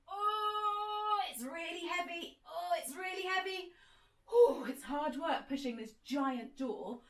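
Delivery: high, strained effort voice; straining to push. Pretend. Teacher miming pushing a giant door, straining.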